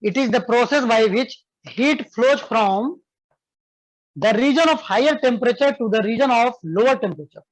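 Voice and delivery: slow, clear dictation for students to write. Shown as a demonstration. Teacher dictating a definition to students, slow and clear. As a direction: spell it out slowly so they can copy. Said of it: "लिखो बाबू".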